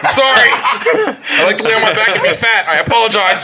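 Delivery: mock apology.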